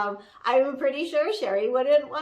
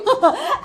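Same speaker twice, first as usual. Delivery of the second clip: high giggle; 12 semitones higher, 8 dB louder than usual. Real, not acted. Laughs after okay.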